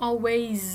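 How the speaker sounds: "always" drawn out with a buzzing z at the end; voiced all through. Shown as a demonstration. Teacher explaining vowel endings, "always" ending in voiced z. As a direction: drag the voicing of "always" through the final s as z. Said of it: "มันก็เป็น voice ลากกันไปทั้งหมด".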